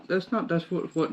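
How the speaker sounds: usual speaking voice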